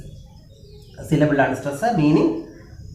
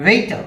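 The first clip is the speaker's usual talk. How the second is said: Waiter opened high on its first syllable; first-syllable stress; 8 semitones higher, 4 dB louder than usual. Shown as a demonstration. Teacher showing stress, first syllable of waiter high. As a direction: punch the beginning of waiter. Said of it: "first syllable ആണ് stress".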